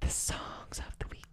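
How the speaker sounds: hushed unvoiced whisper. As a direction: breathe it out in a whisper. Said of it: "Why are you whispering?".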